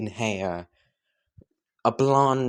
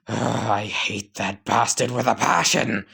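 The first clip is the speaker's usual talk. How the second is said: plain reading voice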